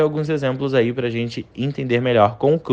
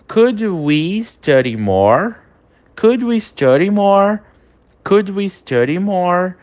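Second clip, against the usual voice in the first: slow, rising higher across the takes; slow English model sentence; 6 semitones higher, slower than usual. Shown as a demonstration. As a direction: model it slowly for repetition. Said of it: "última frase aí de exemplo". (pergunta em inglês lenta e cada vez mais aguda)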